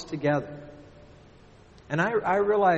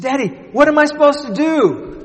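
put-on scared little girl; 13 semitones higher, 12 dB louder than usual. Trapped daughter calling down to her father, frightened. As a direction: plead like a terrified little girl. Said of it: "She said".

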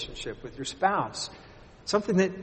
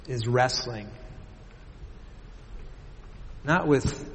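prayer; 2 semitones lower than usual. Acted. Pastor praying for the congregation, earnestly.

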